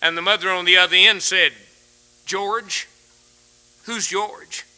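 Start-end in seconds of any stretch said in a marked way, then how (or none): 2.2-4.6 puzzled question. Acted. Mother puzzled on wrong number.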